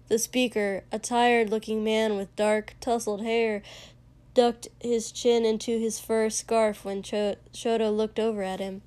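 usual speaking voice

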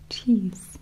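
usual speaking voice

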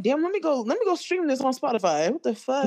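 high-pitched, amazed self-quote; shocked reaction. Retold or imitated. Retelling being amazed by a TikTok song, shocked. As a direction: replay your astonishment, pitched well up. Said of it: "I was so shocked".

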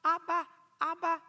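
put-on high child's voice calling Abba, Abba; little boy calling. Little boy tugging at his dad's trunks, high pleading call.

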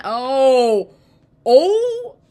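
startled loud "oh!"; real loud yelp. Real, not acted. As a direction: cry out sharply, interrupting yourself. Jostled mid-quote, crying out.

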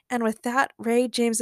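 plain reading voice

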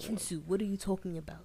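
African accent slipping into British; badly faked African accent, oddly British; mock British-African accent; attempted African accent. Shown as a demonstration. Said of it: "why do you sound British right there".

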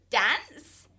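loud, rising surprised Dance; surprised question. A narrator's reading. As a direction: echo it in disbelief. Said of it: "they asked".

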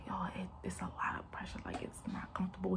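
fast, breathy whisper. Retold or imitated. Mother tells boyfriend about the pressure, whispering. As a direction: whisper it quickly, strained.

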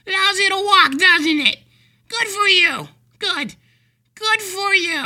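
very high, loud sing-song praise; mocking patronizing stranger. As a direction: coo at a man on crutches. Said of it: "do you go up to him and say".

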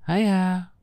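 high, loud cheery hail; bright greeting. Acted. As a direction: call down a chirpy hello. Cheerful woman calls from above.

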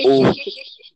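audio glitch jumps pitch up; garbled glitchy connection; pitch wobble glitch. Real, not acted. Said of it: "O áudio tá bugando também".

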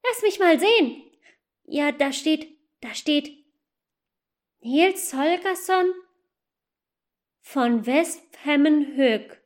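put-on high, lively little goose girl; a young girl excitedly telling her brother; eager girl. Asa tells little brother Matz about the elf, eager and high. Child reads name aloud.

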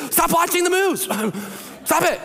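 emphatic command. Real, not acted. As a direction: scold the room to switch it off. Pastor scolds congregation about news.

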